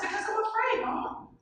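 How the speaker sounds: small and frightened, confessing to mom; scared child. Retold or imitated. Child admits fear.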